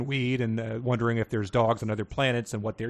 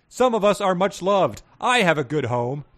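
dog character voice; 6 semitones higher, 6 dB louder than usual. Acted. Mongrel dog replies to Sirius.

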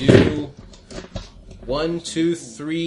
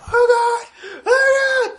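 very high, loud, panicked crying out; terrified screams; 7 dB louder, slower than usual. Acted. Frightened soldier crying out as the monsters shrug off bullets. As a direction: shriek like a soldier in terror.

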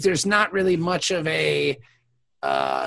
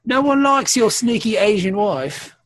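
higher, louder bratty Julian; 5 semitones higher, 4 dB louder than usual. Retold or imitated. Julian taunting O'Brien about his wife, bratty voice. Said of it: "I like your new Julian voice".

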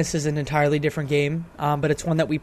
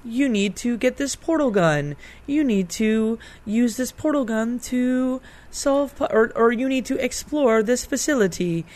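higher-pitched, pleasant computer announcer; put-on computerized lady, measured and higher; 7 semitones higher than usual. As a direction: imitate a pleasantly polite computer voice. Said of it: "it starts off very pleasantly".

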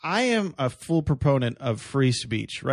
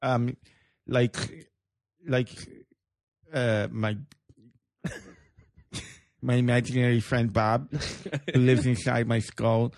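mocking speech impediment voice. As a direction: talk like your tongue is too big. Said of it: "they just talk like this".

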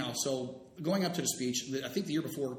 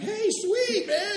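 very high, loud, over-keen cheer; mock excited; 8 dB louder, much slower than usual. Teacher celebrating to an unhappy colleague, mock excited. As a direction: whoop it with hammed-up delight. His delighted reply to unhappy Keltner.

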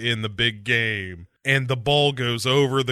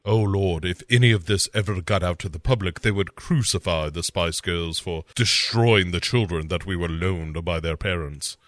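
a lightly voiced, lower fretful manager; fretting manager; 5 semitones lower than usual. [name] to Deborah, lightly voiced fretful manager. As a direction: worry aloud like a panicked manager.

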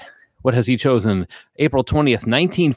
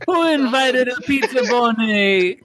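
laughing; 12 semitones higher than usual. Real, not acted. Group laughing at pizza bony.

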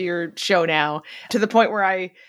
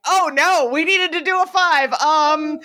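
mock flustered host; 6 semitones higher than usual. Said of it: "it sounds very familiar to me".

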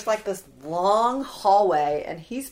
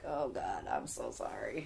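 much lower, quiet murmured sorry; soft apology; 8 semitones lower, 12 dB quieter than usual. Retold or imitated. Patient apologizing quietly to the nurse, low murmured sorry. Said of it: "I was like".

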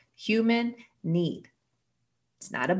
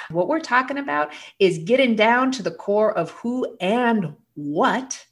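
ordinary talk, nothing marked

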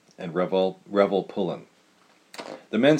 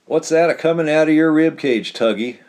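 high, loud crowing taunt; 7 semitones higher, 8 dB louder than usual. A narrator's reading. Old cowboy Revel crowing at his partner Tug. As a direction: crow it out, tickled at the sight. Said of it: "crowed Revel".

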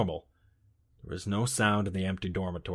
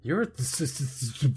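stammering s-sounds back; mock stutter; 3 semitones higher than usual. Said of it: "stutter much?".

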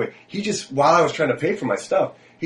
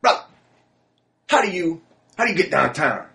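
put-on much higher, eager drunk stranger voice; imitating the drunk guy; 9 semitones higher than usual. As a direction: pitch it way up like a tipsy guy asking directions. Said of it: "so he goes to me".

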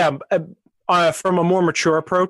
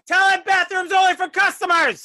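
shouted order; 11 semitones higher than usual. Acted. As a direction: shout it across the store. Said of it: "I'm telling him".

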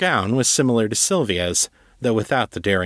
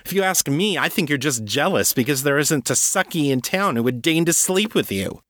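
much higher, sneering mockery; scornful taunt; 6 semitones higher than usual. Sylvia mocking the agent as jealous, high and sneering. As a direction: jab at him where it hurts.

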